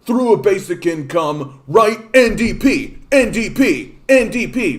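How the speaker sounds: loud, high hyped-up bro voice; frat boy. Retold or imitated. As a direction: pump up the crowd like a frat bro. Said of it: "be like".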